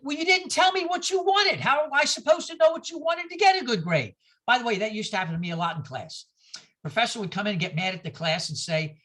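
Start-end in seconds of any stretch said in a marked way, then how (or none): 0.0-4.2 higher pitched indignant student protest; higher, aggrieved student complaint. Retold or imitated. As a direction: push back like a frustrated student. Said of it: "You say".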